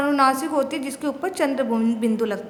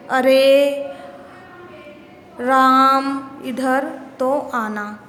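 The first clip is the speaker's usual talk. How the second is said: loud, long drawn-out call, vowels stretched; calling from afar; 5 dB louder, much slower than usual. Shown as a demonstration. Teacher demonstrating how to call someone from far away, loud drawn-out call. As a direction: call out to Ram across a distance, stretching every vowel. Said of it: "किसी को दूर से बुलाने के लिए".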